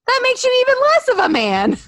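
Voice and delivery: high, loud, mock outrage; indignant wife. Wife berating Mike for doubting, mock indignation. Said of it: "She'll be like".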